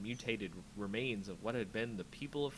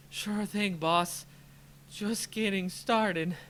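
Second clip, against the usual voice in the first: louder, higher, slow tough-it-out reply; winded bravado; 8 semitones higher, 7 dB louder, slower than usual. Acted. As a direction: gasp it out but cocky. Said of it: "breathing hard".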